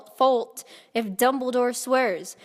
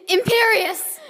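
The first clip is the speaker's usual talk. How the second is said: higher, louder incantation; Voldemort's spell shout; 7 semitones higher, 6 dB louder, slower than usual. Acted. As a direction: cast the curse like a wizard. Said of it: "Voldemort shouted".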